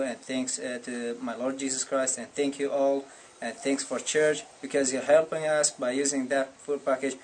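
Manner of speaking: normal talking voice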